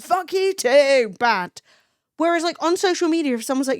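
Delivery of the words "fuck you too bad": louder, slow cheeky comeback; mock retort. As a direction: fire it back slowly with mock cheek. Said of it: "And I'm like".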